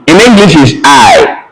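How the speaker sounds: normal talking voice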